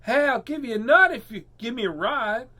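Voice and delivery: high, loud squirrel voice; high-pitched storybook squirrel shout; squirrel calling out. A narrator's reading. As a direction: call out to a passing car as a squirrel. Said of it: "Squirrel called out".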